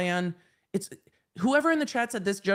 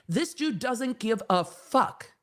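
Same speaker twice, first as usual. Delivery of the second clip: ordinary delivery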